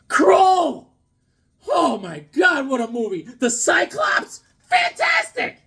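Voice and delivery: loud, very high drawn-out fan gushing; excited raving. Real, not acted. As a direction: rave at the top of your range, stretching words. Gushing over a favourite eighties movie.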